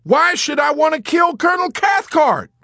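loud, high-pitched outraged shout; alarmed shout. A narrator's reading. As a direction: yell it in disbelief. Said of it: "shouted Dobbs".

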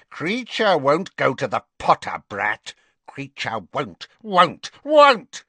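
high, croaking servant voice, sulky and stubborn; a defiant little elf clinging to his old mistress; bitter house elf. Acted. Kreacher the house elf refusing to serve Harry, stubborn.

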